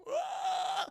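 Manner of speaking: very high ghostly wail; eerie ghost girl shriek; ghost screech. Retold or imitated.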